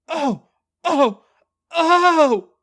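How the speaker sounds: high, loud panting cries. Acted. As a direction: pant out each cry. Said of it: "panted Skip".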